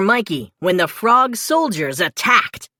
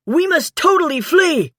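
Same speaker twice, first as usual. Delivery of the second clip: higher urgent call; crying out; 9 semitones higher, 3 dB louder than usual. A narrator's reading. Napoleon urges everyone to flee the fire, higher and urgent. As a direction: urge everyone to run. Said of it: "Napoleon cried".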